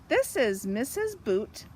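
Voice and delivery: ordinary delivery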